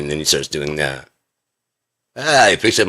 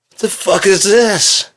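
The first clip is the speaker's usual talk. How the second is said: high, loud and drawn-out; slow incredulous shout; incredulous outburst; 14 semitones higher, 7 dB louder, slower than usual. Acted. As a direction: yell it in high-pitched disbelief. Outburst after retold exchange.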